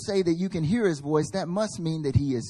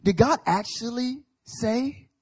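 higher, slow, insinuating Satan question; sly tempter; 8 semitones higher, 4 dB louder, slower than usual. Retold or imitated. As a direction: plant doubt, drawn out. Satan questioning Eve, repeated.

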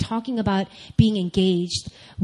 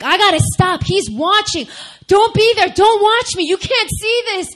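high, loud panicked pleading, pitch climbing; 13 semitones higher, 7 dB louder than usual. Retold or imitated. Reliving her dream, begging her fiance not to watch, panicked high shout. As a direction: beg frantically, voice up high and shouting. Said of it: "I'm freaking out".

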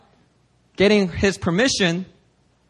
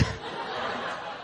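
breathless laugh; 15 dB quieter than usual. Real, not acted. Laugh at own story.

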